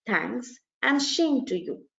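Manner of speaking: "shame" said with a sh sound instead of s; mispronounced shame. Shown as a demonstration. Teacher showing a funny mistake, saying "shame" with a sh. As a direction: slowly say "shame to you" with a clear sh. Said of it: "instead of saying "Same to you," what if the person says".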